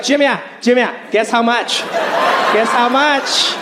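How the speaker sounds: mom teasing in a sing-song voice; sing-song accented mom asking him to guess the price. Retold or imitated. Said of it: "She would be like".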